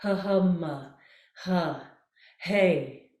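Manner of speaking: voice test sounds. Shown as a demonstration. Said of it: "a little voice test".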